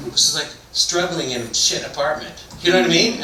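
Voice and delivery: normal talking voice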